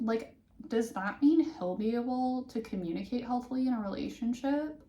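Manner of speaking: ordinary delivery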